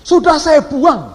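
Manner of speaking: loud, high, slowly drawn-out answer; imitated angry retort. Angry foreign passenger refuses the boarding pass, imitated angry retort. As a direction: throw it back loudly, acting angry. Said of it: "bule sudah marah besar".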